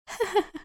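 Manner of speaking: short high sinister giggle; creepy doll giggle. Acted. Doll laughs after taunt.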